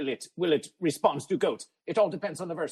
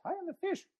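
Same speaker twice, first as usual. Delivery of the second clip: amazed squeak; 13 semitones higher, 5 dB quieter than usual. Acted. Mock amazed pitch leap.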